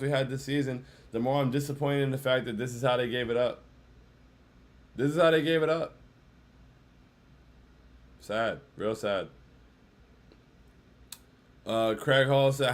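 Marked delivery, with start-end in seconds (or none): none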